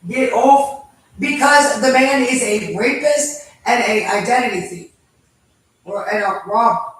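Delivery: loud furious outburst; shaking with anger. Real, not acted. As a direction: shout it out in real rage. Real angry confrontation at home.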